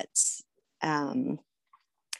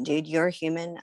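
ordinary talk, nothing marked

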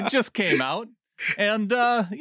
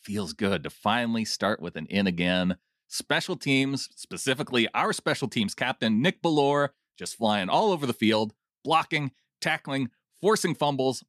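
steady read-aloud of a fan's note, a bit lower; reading a comment; 5 semitones lower than usual. Acted. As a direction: read the listener's words out for the show. Reads listener comment aloud.

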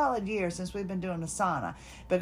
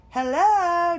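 higher, louder hello through the door; calling out; 8 semitones higher, 6 dB louder than usual. Retold or imitated. Calling from the sauna to the therapist, bright hail. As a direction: call out to check on someone.

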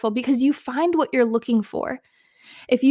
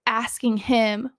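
ordinary delivery

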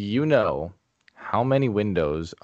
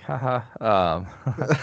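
nervous laugh; 4 semitones higher, much slower than usual. Retold or imitated. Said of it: "I was like".

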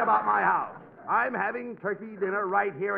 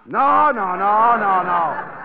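loud repeated no; 10 dB louder, much slower than usual. Acted. Abbott refuses Costello's self-invite.

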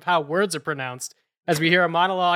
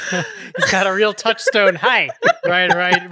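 talking through real laughter; laughing; 3 dB louder, much slower than usual. Real, not acted. Co-host reacting to the accent clip, laughing while talking. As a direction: laugh your way through the remark.

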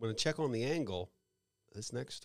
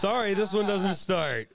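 car dealer voice; 6 semitones higher, 7 dB louder than usual. Pretend. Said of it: "If I was the dealer".

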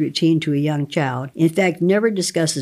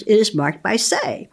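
normal talking voice

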